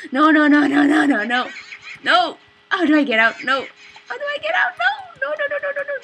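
loud, then high frantic pleading; panicked no's. Real, not acted. Player trapped by the flower, panicking. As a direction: panic as the trap closes.

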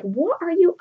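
high, impatient, fed-up question; a lover losing patience, rising high; exasperated Roxanne. Retold or imitated. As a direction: scold him for being so dull. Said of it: "She makes a face at him like".

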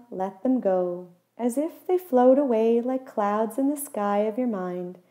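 normal talking voice

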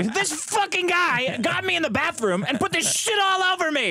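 high-pitched mock outrage; staged fight. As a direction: play-act accusing your partner in a public row. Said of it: "We have to fight on each other".

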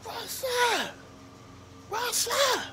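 high cocky snake voice; mock tough snake asking what's up. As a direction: play the snake, squeaky and cocky. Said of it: "that snake was like".

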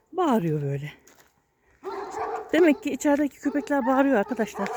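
usual speaking voice

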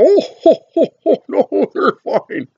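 high, loud laughing brush-off, slowing at the end; booming laugh. Retold or imitated. Grandpa laughing off his wife's warning, high and loud. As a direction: laugh it off like a happy prankster. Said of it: "And he'd go".

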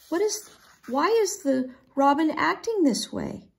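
high, anxious asking; puzzled question. A narrator's reading. Kendall questions Willa, puzzled.

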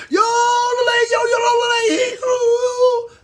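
high, loud mock yell; mock scream. Imitating someone screaming at grandma's house, hammed-up yell. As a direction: belt it out like screaming to the woods.

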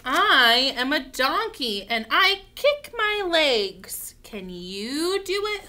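slow, loud picture-book read-aloud; reading to children. Acted. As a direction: stretch each word out boldly for little ones.

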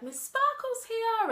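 high, loud and slow, sing-song it out; children's entertainer voice. Retold or imitated. Nursery worker greeting kids as Miss Sparkle, high, slow and loud. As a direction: announce yourself like a sparkly party character to toddlers. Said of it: "and I would be like".

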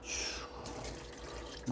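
whispered whoosh effect; whoosh sound. Acted. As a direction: breathe a soft whoosh. Pouring water sound effect.

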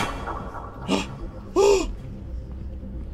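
long soft frightened gasp; real gasp; scared gasp. Real, not acted. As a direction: gasp in dread. Scared again.

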